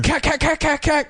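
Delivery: high rapid cack cack gunfire sounds; mock gunfire noise. Retelling a comedy bit, high mock gunfire noises.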